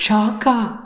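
high startled question, a sudden jump up; alarmed echo. Acted. Hearing about shock treatment, a startled high question. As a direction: snap up in alarm at the word.

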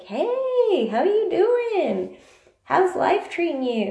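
high, bright over-friendly hello; cheery greeting. Retold or imitated. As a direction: greet a stranger like an old friend. Cheery greeting to a stranger in line.

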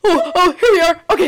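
high, loud sudden arrival; breakthrough surprise. Acted. Actor miming her character finally clicking, surprised. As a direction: burst out as if finally reaching the top.

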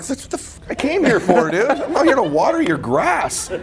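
high, laughing mock protest; telling the mentor he came for business, not yard work, laughing; laughing exasperation. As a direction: laugh through a disbelieving retort. Exasperated reply to odd host.

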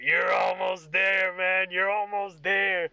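put-on loud, slow drunken cheering; Craig. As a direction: egg them on, drawn out and high. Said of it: "And he's pointing at you".

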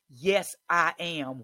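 slow loud declaration; yelled affirmation. Shown as a demonstration. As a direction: yell it back at him slowly. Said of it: "you have to yell back".

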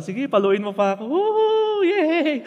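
high, gleeful cheering, hammed up; mock delight. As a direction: whoop with sarcastic glee, pitch soaring. Mocking a child delighted at spanking.

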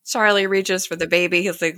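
normal talking voice

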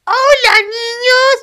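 put-on very high, loud children's-host greeting; creepy TikToker impression. Guest imitating a creepy TikToker greeting children, shrieky high voice. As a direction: squeal it out like an over-the-top kids' entertainer. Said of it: "el chabón ese que decía".

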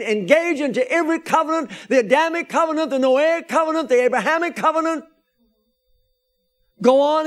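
high, loud preaching to the congregation; full-force preaching. Acted. Pastor preaching covenants to his church, in a high, loud voice. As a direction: preach it out with fire.

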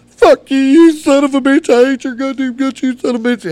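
high, loud screamed cussing; imitating an enraged woman's tirade; furious girlfriend. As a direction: shriek it as an outraged girlfriend. Girlfriend's furious reply acted out.

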